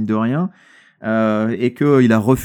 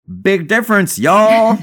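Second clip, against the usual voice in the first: hammed-up Texan drawl, higher and louder on y'all; Texan accent; 8 semitones higher, 5 dB louder, much slower than usual. Reading the bat fact box to cohosts in a mock Texan accent. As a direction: play a folksy Texan ad voice, y'all thrown out big. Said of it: "J'ai essayé de faire mon meilleur accent texan avec un y'all".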